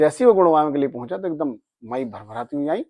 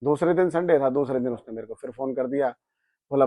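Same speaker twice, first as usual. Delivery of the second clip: normal talking voice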